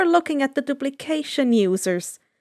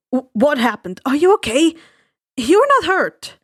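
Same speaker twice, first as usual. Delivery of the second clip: worried friend; 2 semitones higher, 6 dB louder than usual. Acted. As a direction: fuss over her in alarm. Worried friend checks Inko over.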